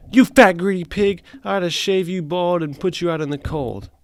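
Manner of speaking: hammed-up scolding of a pet; lightly voiced furious John; mock angry John. Reading John's angry line.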